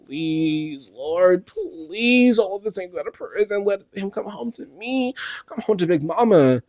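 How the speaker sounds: high-pitched pleading to the Lord; hammed-up high, wailing plea; mock desperate prayer. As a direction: imitate a woman begging God for her man. Voices a desperate prayer for partner.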